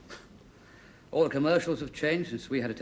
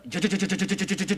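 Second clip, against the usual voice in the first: rapid repeated juddering noise, raised pitch; imitated machine chatter; 5 semitones higher, 3 dB louder than usual. Husband checking which noise the generator made, imitated machine chatter.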